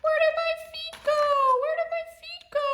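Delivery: high, bouncy little toy voice, full of glee; playful toy character. Acted. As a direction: play a cheerful little toy who just rode in. Toy character hiding its feet.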